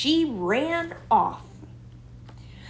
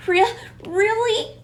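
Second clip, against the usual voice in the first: panting; 12 semitones higher, 3 dB louder than usual. A narrator's reading. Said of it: "panted Rosie".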